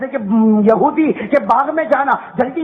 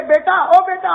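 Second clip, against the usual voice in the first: a mother's raised call to her son, a little higher; 8 semitones higher than usual. Retold or imitated. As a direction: call out to the boy like his mother. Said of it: "وہ ماں کہتی ہے". (بیٹے کو پکارتی ماں کی آواز)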